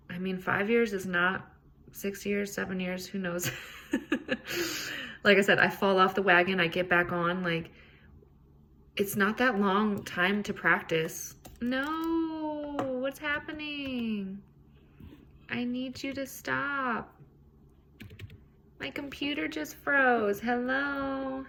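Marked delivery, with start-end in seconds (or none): 3.5-5.2 high, soft giggle; real light chuckle at oneself; laugh. Real, not acted. Laughs at herself after "who knows. 11.6-21.4 high, drawn-out lament; dismayed plea. Real, not acted. Computer freezes mid-recording.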